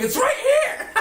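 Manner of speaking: high-pitched screaming through laughter; laughing scream. Pretend. Waving at aliens in the bar, laughing high scream. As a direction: yell it out while cracking up. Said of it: "and screaming".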